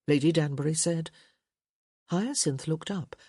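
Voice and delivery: ordinary delivery, nothing marked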